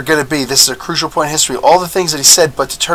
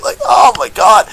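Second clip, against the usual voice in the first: higher, excited exclamation; real excited shout; 9 semitones higher than usual. Real, not acted. Fan thrilled by a jungle ambush scene, excited shout. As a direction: blurt it out thrilled.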